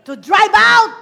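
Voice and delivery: high, loud preaching shout; full-force preacher shout. Acted. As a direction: blast it out to the crowd.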